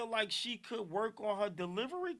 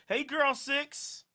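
calling out; 6 semitones higher, 6 dB louder, much slower than usual. Real, not acted. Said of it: "host calling out to caller".

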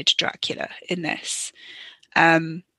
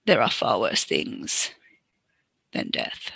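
lower, slow, softer Dracula voice; mock Dracula; 10 semitones lower, 5 dB quieter, slower than usual. Viewer quoting Dracula, lower and slow. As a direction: drop low and slow into the vampire line.

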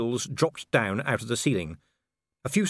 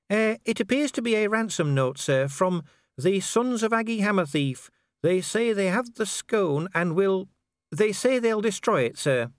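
higher-pitched, dutiful junior officer; hesitant corporal Cheery; 11 semitones higher than usual. Acted. Corporal reads ransom note to sir, hesitant. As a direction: report haltingly to your superior.

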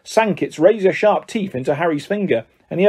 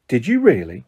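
usual speaking voice

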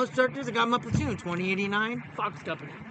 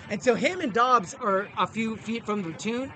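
ordinary delivery

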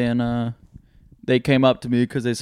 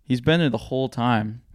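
ordinary delivery

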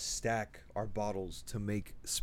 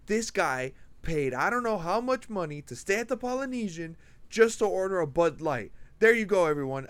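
higher, louder mock announcement over a megaphone; bartender on megaphone; 7 semitones higher, 4 dB louder than usual. Bartender roasting a Bud Light order, mock megaphone call. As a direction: roast the guest like a bartender on the mic. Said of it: "the bartender grabs a megaphone and goes".

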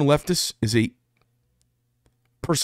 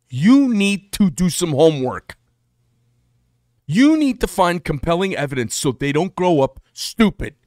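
louder, higher insistence; ranting, raised voice; 5 semitones higher, 5 dB louder than usual. Real, not acted. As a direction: hammer it at the parents. Ranting at parents.

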